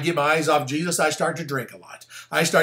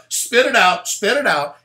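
loud, raised mock order; mock unsympathetic Jesus; 6 semitones higher, 5 dB louder than usual. Preacher acting how Jesus didn't rescue Peter, loud mock scolding. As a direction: call it out high and loud, hammed up. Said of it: "he did not just go".

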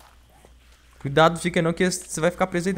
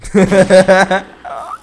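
loud laugh; 5 semitones higher, 12 dB louder than usual. Real, not acted. Laughs at catapora joke.

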